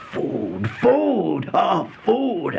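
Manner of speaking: from a plain word to a high, loud cry; a starving man shouting food at the sight of it; rising ecstatic cry. A narrator's reading. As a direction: build from murmur to a famished cry.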